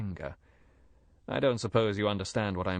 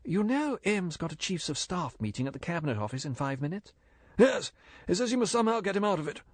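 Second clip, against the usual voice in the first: higher woman's voice, hurried and urgent; anxious secretary; 8 semitones higher than usual. A narrator's reading. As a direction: press the lie home, fast. Moneypenny voiced, high and urgent.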